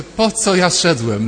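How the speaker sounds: loud, slow, fed-up outburst; exasperated thought aloud. Retold or imitated. Priest recalling his errand, loud, slow exasperation. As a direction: throw up your hands and say it loudly, slowly.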